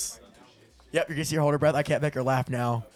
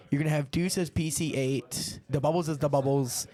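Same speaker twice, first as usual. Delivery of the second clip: ordinary delivery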